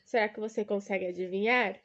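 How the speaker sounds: normal talking voice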